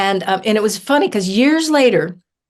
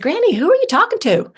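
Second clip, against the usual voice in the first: high, quick curious question; child asking granny; 11 semitones higher, faster than usual. Retold or imitated. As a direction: call it up to granny, puzzled. Child questioning grandmother, retold.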